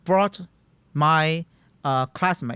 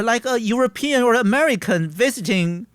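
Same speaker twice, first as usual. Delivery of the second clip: ordinary talk, nothing marked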